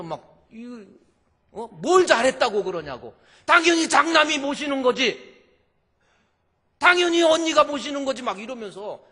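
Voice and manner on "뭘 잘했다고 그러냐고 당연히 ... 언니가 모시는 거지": high, loud scolding; scary sisters-in-law. Retold or imitated. Sisters-in-law insisting the eldest must take him, loud scolding. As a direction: berate her indignantly, getting higher. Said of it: "막 신우들이 무섭잖아요".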